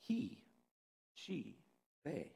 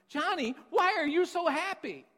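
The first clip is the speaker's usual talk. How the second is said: upbeat parent question; 13 semitones higher, 9 dB louder than usual. Acted. Parents ask happy Johnny.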